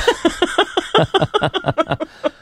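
squealing laugh; quick high giggle. Real, not acted. Laugh after joke.

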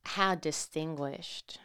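slow, soft, dismissive sneer; disgusted. Acted. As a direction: drawl it with disdain. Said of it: "She said in disgust".

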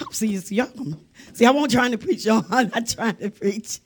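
low, soft words through laughter; laughing. Real, not acted. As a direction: chuckle through an aside to the congregation. Breaks up laughing mid-sermon.

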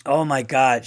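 ordinary talk, nothing marked

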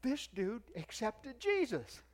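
high, loud, drawn-out excited announcement; excited son. Retold or imitated. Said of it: "Jay was so excited".

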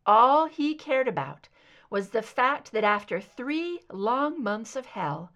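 plain reading voice

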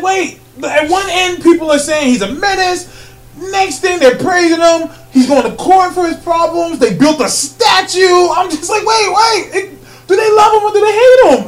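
high-pitched confused rant building to laughter; exasperated rant. Real, not acted. Podcast host ranting to co-host about the film, exasperated. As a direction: let the confusion run away with you, rising.

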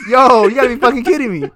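loud, high, cracking up mid-sentence; laughing disbelief. Real, not acted. Worker retelling a greedy customer, laughing loudly. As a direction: laugh it out, barely able to believe it.

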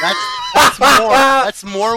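sudden high, loud outburst; excited shout. Real, not acted. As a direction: burst out with delight. Excited outburst at chimps idea.

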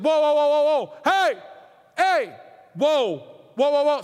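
loud, urgent "whoa" to stop everyone; calling a halt. Acted. Preacher as Caleb silencing the people, shouted halt. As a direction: cut the crowd off with sharp shouts.

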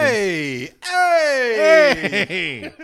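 loud, drawn-out "hey"; mock protest shout. Co-host blamed for the tangents, loud mock protest. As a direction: cry out in rising mock offence.

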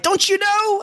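high, mocking "don't you know"; mock incredulous. Mocking the film's smug question, high incredulous tone. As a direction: sneer it like it's obvious.

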